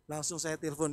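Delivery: usual speaking voice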